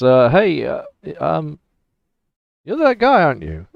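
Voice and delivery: hesitant stranger sizing up the innkeeper; a hint of a nervous traveller; stranger's voice. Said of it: "he says".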